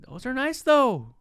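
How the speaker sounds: high-pitched friendly defence; hyping up. Acted. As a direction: stick up for the shoes, pitched right up. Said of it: "I'm always hyping 'em up".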